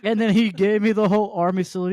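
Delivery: ordinary delivery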